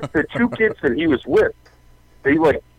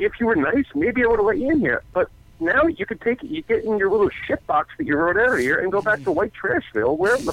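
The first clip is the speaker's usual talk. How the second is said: high-pitched scornful rant; retold telling-off; 8 semitones higher than usual. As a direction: re-enact scolding a caller on the phone. Retells telling off parent on phone.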